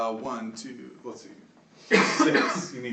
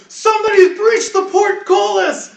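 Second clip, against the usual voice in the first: high, loud guard's cry of alarm; alarmed shout; 9 dB louder than usual. Acted. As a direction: raise the alarm across a castle yard. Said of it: "somebody shouts".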